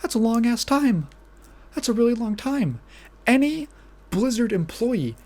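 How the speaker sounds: ordinary talk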